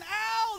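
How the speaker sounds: sharp high "Ow!" of a man being chiseled; mock cry of pain. Man in the skit yelping at God's chisel. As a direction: yelp it like the chisel just hit. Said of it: "the guy would say".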